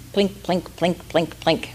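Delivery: soft ukulele plinks. A narrator's reading. Ukulele sound imitated softly.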